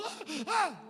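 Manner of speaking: high, short mock screams; high-pitched yelps of alarm; imitated panicked cries. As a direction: cry out like startled passengers.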